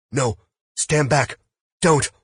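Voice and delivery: high, loud plea to stop; alarmed cry. A narrator's reading. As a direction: cry out in shock at the gun. Victim sees gun aimed at him.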